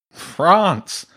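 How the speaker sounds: high, loud disdain; scornful snort. A narrator's reading. Badger dismissing James's holiday in France, scornful. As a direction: dismiss it with one emphatic word. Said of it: "the badger snorted".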